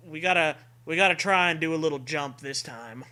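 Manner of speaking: normal talking voice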